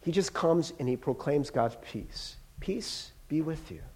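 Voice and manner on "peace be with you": very soft, peaceful blessing; hushed and unforced, much softer; gentle proclamation. Retold or imitated. Jesus proclaims peace without demands, hushed and gentle.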